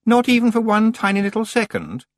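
high, loud teasing; bright sing-song needling; playful voice. Acted. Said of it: "playful voice".

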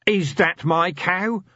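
deranged dwarf-hunter demanding an answer. Acted. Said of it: "the creature demanded".

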